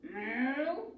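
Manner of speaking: a lowing cow sound; cow moo. A narrator's reading. Cow mooing back at the witch.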